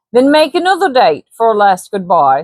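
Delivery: light Cockney trader voice; Cockney Hanson. Acted. Hanson flatters Baines, light Cockney persuasion.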